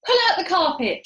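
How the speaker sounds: loud, high command; shouted order. A narrator's reading. Monkey father ordering the carpet pulled, loud shout. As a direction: bark the order. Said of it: "shouted Muggle Rump".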